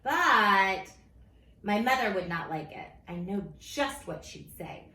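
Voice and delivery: usual speaking voice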